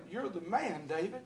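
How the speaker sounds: soft, pointed accusation; a hushed, damning verdict; quiet accusation. Retold or imitated. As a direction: drop the voice and lay the blame. Prophet confronts the king.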